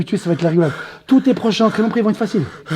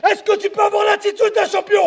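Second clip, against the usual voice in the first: high, insistent shout; shouted challenge; 7 semitones higher than usual. Real, not acted. Coach challenging fighter to be a champion, shouting. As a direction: demand a yes from him.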